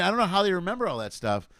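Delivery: ordinary talk, nothing marked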